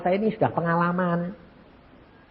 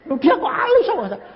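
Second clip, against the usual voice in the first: high, laughing complaint; whining protest; 12 semitones higher than usual. Retold or imitated. Husband complaining to God about his wife's gift, laughing whine. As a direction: grumble to God, half joking. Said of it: "Aku berhenti protes".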